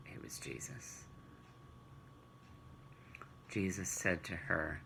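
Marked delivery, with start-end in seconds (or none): 0.1-1.0 whispered, a secret revealed; hushed reveal. A narrator's reading. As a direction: let the big reveal slip out in a whisper. Dramatic reveal of the man.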